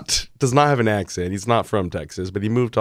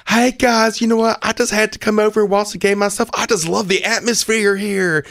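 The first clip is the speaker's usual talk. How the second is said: much higher, hammed-up Southern twang; mock Southern accent; 11 semitones higher than usual. Imitating actor arriving at Little League in fake twang. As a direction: gush like a fake Texan dad. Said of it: "No accent".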